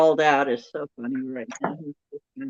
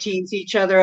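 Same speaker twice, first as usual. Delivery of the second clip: normal talking voice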